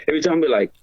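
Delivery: ordinary talk, nothing marked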